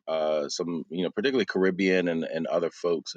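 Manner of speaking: normal talking voice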